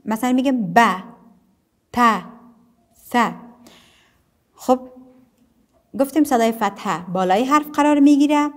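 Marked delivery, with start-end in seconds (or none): 0.7-3.1 "ب، ت، ث" each with short open a; short fatha sounds. Shown as a demonstration. Teacher giving fatha examples, "ب، ت، ث" as short open syllables.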